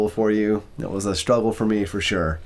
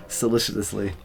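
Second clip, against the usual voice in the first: ordinary delivery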